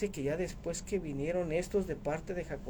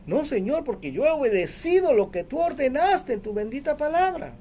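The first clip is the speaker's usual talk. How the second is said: high-pitched, insistent protest; Pedro; 9 semitones higher, 4 dB louder than usual. Acted. Apostle defends his obedience to the Lord, insistent high protest. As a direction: plead obedience emphatically, pitch raised. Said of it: "Lo que está diciendo él".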